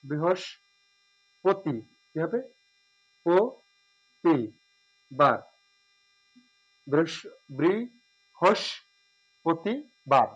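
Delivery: বৃহস্পতিবার very slowly as "bri-hosh-po-ti-bar"; correct pronunciation. Shown as a demonstration. Teacher breaks down a long word for students, very slow. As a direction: drag বৃহস্পতিবার out syllable by syllable. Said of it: "উচ্চারণ হচ্ছে তালবস্য মতো".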